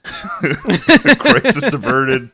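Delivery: slow, drawn out through laughter; wrapping up the story, laughing slow mock relief. Real, not acted. Laughing wrap-up.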